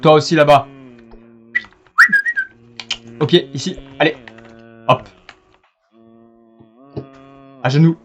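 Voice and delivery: loud mock tyrant order; shouted command. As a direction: bark it at the natives. Ordering natives to kneel.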